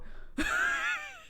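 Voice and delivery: sudden, very high laugh; real laugh breaking out. Real, not acted. Mother laughs.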